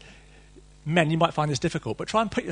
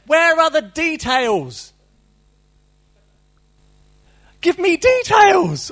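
loud, much higher, slow cry for details; exasperated loud demand for details; 13 semitones higher, 10 dB louder, much slower than usual. Retold or imitated. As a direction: shout it out, begging for more story. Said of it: "I say".

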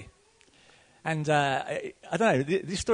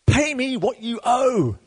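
loud, slow, high threat; a servant throttling his debtor, demanding payment; harsh demand; 9 semitones higher, 5 dB louder, slower than usual. Acted. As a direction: choke out the demand. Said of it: "took him by the throat".

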